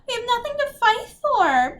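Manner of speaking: very high, loud whine; snuffling wail. A narrator's reading. Beatrix refuses to fight for good, snuffling. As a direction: wail it like a sulky, weeping girl. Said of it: "Beatrix snuffled".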